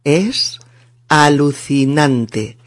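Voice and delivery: 'Es', a pause, then slow 'alucinante'; pronunciation model. Shown as a demonstration. Teacher has learners repeat the phrase, careful slow model. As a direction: spell out 'Es alucinante' in careful separate words. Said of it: "vamos a aprender a decirlo".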